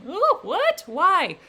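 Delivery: high, loud gasp of surprise; real startled disbelief; shocked reaction. Real, not acted. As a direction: reader shocked by the creature's threat, high and loud. Reader reacts to wedding threat.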